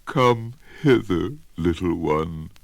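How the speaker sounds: sly crocodile. Acted. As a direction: coax him nearer, slowly and slyly. Crocodile luring the child.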